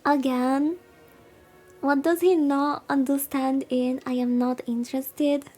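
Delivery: ordinary talk, nothing marked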